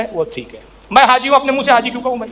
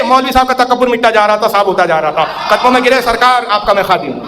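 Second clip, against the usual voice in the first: ordinary talk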